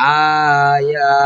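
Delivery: slow, stretched Quranic reading of আয়া; drawn-out recitation. Shown as a demonstration. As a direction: hold the vowels long in আয়া like tajweed recitation. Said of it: "demo of joined reading".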